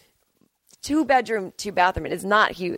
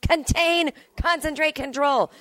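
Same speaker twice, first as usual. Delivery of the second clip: much higher, urgent containment call; mock hazmat alarm; 10 semitones higher, slower than usual. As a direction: sound the alarm in a hazmat lab, high-pitched. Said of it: "like, a hazmat environment".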